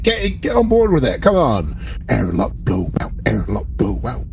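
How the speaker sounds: ordinary talk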